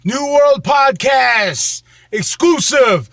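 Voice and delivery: drawn-out announcer shout; show intro shout. Acted. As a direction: hype the show name slowly. Podcast intro call-out.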